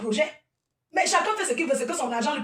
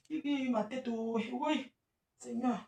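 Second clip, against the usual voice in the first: 1 soft groan; 9 dB quieter, slower than usual. Real, not acted. Taking off tight wig.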